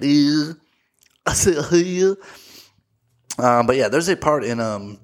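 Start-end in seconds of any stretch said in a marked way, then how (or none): 0.0-2.2 generic Southern accent. Shown as a demonstration.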